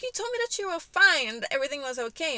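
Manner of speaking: high, loud, rapid mock scolding; indignant accusation. Mother confronts daughter who said she was fine, indignant.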